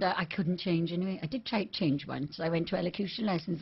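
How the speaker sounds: usual speaking voice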